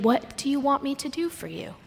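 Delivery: soft, tender question; gentle. Shown as a demonstration. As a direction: ask it softly as an open invitation. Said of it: "read these stories with a gentleness".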